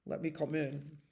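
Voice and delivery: low, soft bear voice. A narrator's reading. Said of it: "said the bear".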